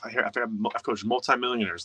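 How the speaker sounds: ordinary delivery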